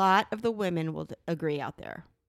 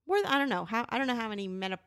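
normal talking voice

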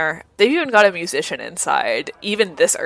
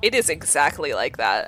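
normal talking voice

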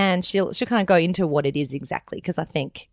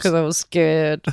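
lips barely moving; through gritted teeth; slower than usual. Real, not acted. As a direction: talk through clenched teeth. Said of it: "talk through your teeth and not even move your lips".

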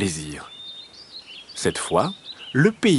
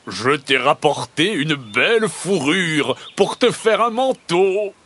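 lightly voiced boastful husband, pitch climbing high; a touch of showing off, higher pitched; proud peasant; 6 semitones higher than usual. A narrator's reading. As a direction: announce a prize with mild pride. Peasant proudly calls to his wife.

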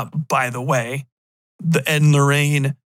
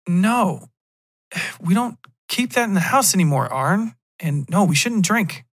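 tag; 5 semitones higher than usual. Acted. Girlfriend refuses him liquor.

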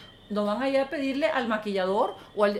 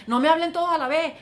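loud, higher snapping shout; imitating a yelling producer; 7 semitones higher, 8 dB louder than usual. Bad producers yelling at their crew, mock angry shout. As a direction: bark it like a stressed boss losing it. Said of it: "Yo he escuchado productores que hacen eso".

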